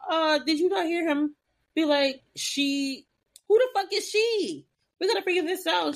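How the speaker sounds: high, incredulous; mock exasperation. Host voicing the sisters confronting Piper, incredulous. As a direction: ask like they're all idiots.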